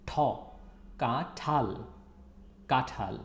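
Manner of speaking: কাঁঠাল with a breathy aspirated ঠ; aspirated th sound. Shown as a demonstration. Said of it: "It's not ট, it's ঠ".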